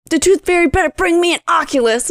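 lightly voiced entitled kid; greedy kid. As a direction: demand it like a spoiled little boy. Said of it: "he was like".

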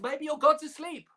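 high teasing jab; mocking. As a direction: taunt them, pitch lifted right up. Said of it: "He mocked them".

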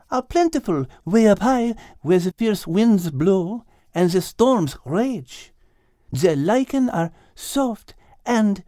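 put-on high, French-accented gentle guide voice; a cheerful French cow warning her friends; high French-accented voice, caution about avalanches; French cow Liz. Liz explains simply to Kate.

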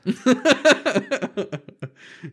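high loud burst of laughter. Real, not acted. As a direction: laugh hard at the story. Laughs at the story.

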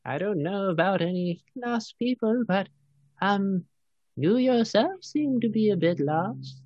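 creaky-sweet crone greeting strangers; kindly old cave woman. Acted. Game master plays old lady.

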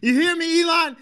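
high, loud call-out at Elon. Real, not acted.

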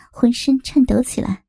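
ordinary delivery

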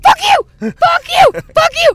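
screaming it at full force, pitch way up; furious shout. Retold or imitated. Girl yells at the guy mocking her crutches, furious shout. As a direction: blow up and yell it over and over.

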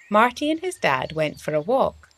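plain reading voice

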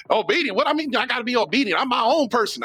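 high, quick protesting objector; indignant objector. Retold or imitated. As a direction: push back like a defiant churchgoer. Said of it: "I know, I know".

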